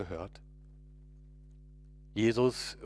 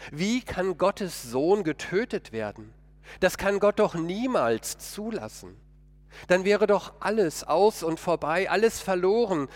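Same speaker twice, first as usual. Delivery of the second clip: higher and louder, rising alarm; dismayed outcry; 8 semitones higher, 5 dB louder than usual. Acted. Peter, shocked that Jesus will die, cries out in dismay. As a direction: cry out in disbelief and despair.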